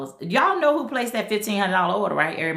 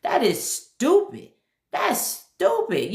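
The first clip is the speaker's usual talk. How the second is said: exasperated; 8 semitones higher, much slower than usual. Real, not acted. Ranting about car payment.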